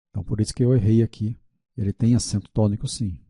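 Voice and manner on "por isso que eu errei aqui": ordinary delivery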